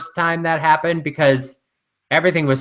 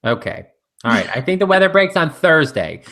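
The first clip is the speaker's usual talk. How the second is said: ordinary delivery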